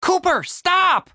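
high, drawn-out cry to halt; urgent warning call. Acted. Tim stops Cooper walking up, urgent warning call.

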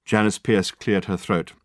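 plain reading voice

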